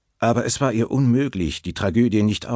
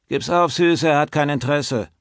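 ordinary delivery

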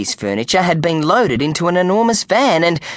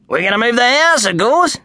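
higher, matter-of-fact workman; cheerful Kenny; 9 semitones higher, 3 dB louder than usual. A narrator's reading. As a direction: state it as obvious. Kenny voiced in story.

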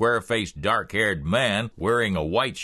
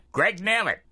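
higher put-on voice for Greg; curt introduction in character; brother-in-law; 11 semitones higher than usual. A narrator's reading.

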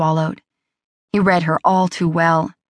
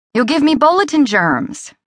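high, bright-pitched teasing exclaim; joking exclamation; 8 semitones higher than usual. A narrator's reading. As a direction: toss it off as a joke, pitched up high. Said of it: "she exclaimed, making a joke out of it".

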